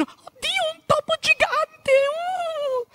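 very high shrieking lady; frightened ladies. Retold or imitated. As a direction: squeal like a startled lady. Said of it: "le signore che dicono".

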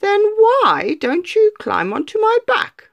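high, honeyed sly fox; sly fox greeting the gingerbread man with a grin; grinning fox. Acted. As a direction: charm the little cookie with a grin. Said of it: "asked the fox".

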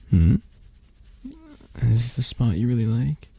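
ordinary talk

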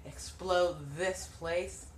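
scheming villain. Pretend. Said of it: "my next evil plan".

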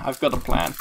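soft sly mutter to yourself; quiet. Shown as a demonstration. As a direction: say it again under your breath.

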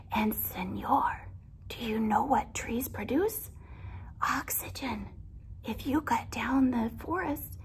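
hushed, urgent whisper. Acted. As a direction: whisper a warning into his ear. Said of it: "whispered to the man".

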